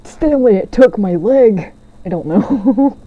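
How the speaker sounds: lightly voiced old man; a hint of a whiny old man. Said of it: "giving him an old man voice".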